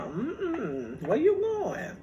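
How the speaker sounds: mm-mm then high where you going; mock scold, sliding way up; scolding mom. Daughter scolding her mom for dressing up. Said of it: "I have to tell her".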